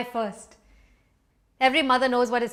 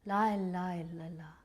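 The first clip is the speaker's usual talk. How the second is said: low, soft chant fading away; murmured refrain; 4 semitones lower, 8 dB quieter than usual. A narrator's reading. Overwhelmed mother repeating words to herself, murmured. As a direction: murmur it to yourself, sinking quieter. Same refrain on seeing police car.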